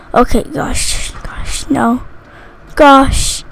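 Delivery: very soft, low whisper; nervous whisper. Real, not acted. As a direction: whisper it to yourself, worried. Nervous at being sheriff.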